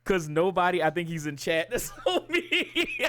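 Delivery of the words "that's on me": high-pitched, laughing through the words. Real, not acted. Streamer owning up to chat, laughing. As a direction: crack up while talking. Cracks up at own remark.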